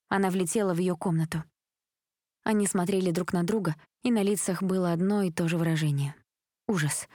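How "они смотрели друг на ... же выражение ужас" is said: usual speaking voice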